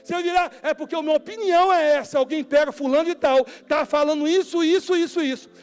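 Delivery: loud, high fiery warning; preaching at full force. Acted. Pastor warning his flock about gossip, loud and fiery. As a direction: preach it hard to the church.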